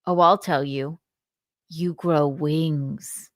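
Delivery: slow, drawn-out punchline on you grow wings; lightly imitated dad, slow deadpan. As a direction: deliver the joke dead slow like a dad.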